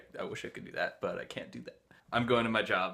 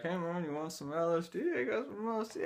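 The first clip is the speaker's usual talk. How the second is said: laid-back hippie; 7 semitones higher, 3 dB quieter than usual. Retold or imitated. Hippie offering LSD.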